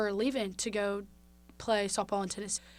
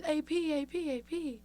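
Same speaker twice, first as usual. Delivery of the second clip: higher, happy calling of "A P"; friend calling out; 7 semitones higher than usual. Retold or imitated. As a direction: call her initials out like a delighted friend. Friend calling out her name happily.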